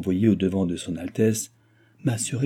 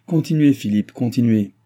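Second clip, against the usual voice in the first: louder, higher, pressing command; impatient urging; 3 semitones higher, 5 dB louder than usual. A narrator's reading. Baron pressing his son to continue, impatiently. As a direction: urge him on insistently.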